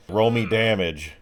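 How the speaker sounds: normal talking voice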